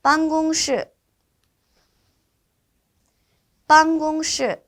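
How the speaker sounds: high, held 办公室 in careful Mandarin; repeat-after-me model. Shown as a demonstration. Teacher leading students to repeat 办公室, careful high model. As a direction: sustain the first syllables of 办公室 for learners. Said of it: "같이 한번 따라서 읽어보도록".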